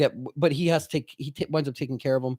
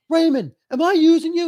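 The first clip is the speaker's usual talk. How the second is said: much higher, louder exasperated brother; pitched-up frantic question to Raymond; Tom Cruise; 14 semitones higher, 6 dB louder than usual. Retold or imitated. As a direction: brother pleading in the hotel argument. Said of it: "Tom Cruise is like".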